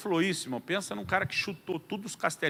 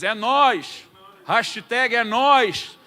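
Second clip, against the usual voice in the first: loud, raised voice, stretched out; rousing shout; 4 semitones higher, 9 dB louder, much slower than usual. Acted. Preacher voices Isaiah volunteering to God, rousing shout. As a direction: call it out like an eager volunteer.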